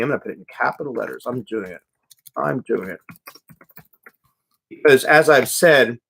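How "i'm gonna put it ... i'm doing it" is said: muttering to self. Real, not acted. Mutters to self while typing.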